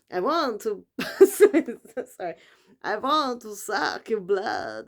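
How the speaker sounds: slow, drawn-out vampire voice with a laugh; menacing vampire, slow and drawn out; vampire impression. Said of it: "That's my vampire impression".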